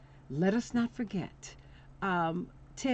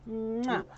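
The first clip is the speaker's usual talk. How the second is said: blown kiss; 3 semitones higher than usual. Pretend. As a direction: blow a second kiss. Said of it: "Remember how they used to do that".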